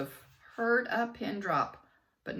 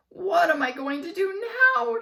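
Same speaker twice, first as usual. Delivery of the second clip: very high, loud panicked shout; 12 semitones higher, 10 dB louder than usual. A narrator's reading. Teacher shouting in panic over her job. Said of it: "shouted Miss Jojo".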